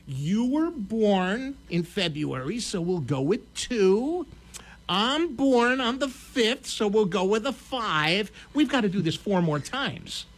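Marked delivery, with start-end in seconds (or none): 0.1-8.3 very slow, deliberate imitation of the wife; drawn-out number picking. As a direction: stretch each phrase as if pondering every pick. Wife slowly picking numbers, retold.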